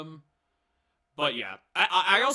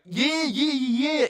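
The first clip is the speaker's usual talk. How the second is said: high, louder hyped-up yeahs; mock Joaquin; 10 semitones higher, 8 dB louder, slower than usual. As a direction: bounce the yeahs like an eager sidekick. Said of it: "He's like".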